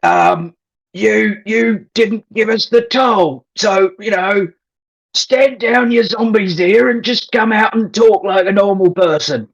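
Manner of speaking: sling a bad Aussie troll voice at the monks; bad throaty Aussie troll. Player mimicking the head troll to the hidden voice, bad throaty Aussie accent. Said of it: "I wanna mimic the trolls... Thomas, the head troll".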